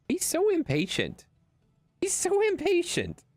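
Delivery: very high-pitched doting exclamation; high squeaky pet talk; amused pet-owner squeal. Real, not acted. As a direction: squeal it over the eager dog. Cooing over the impatient dog.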